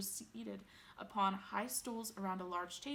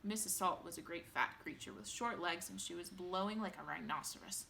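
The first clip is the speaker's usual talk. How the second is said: usual speaking voice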